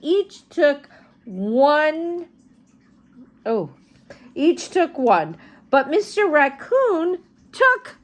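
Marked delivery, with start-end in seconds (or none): none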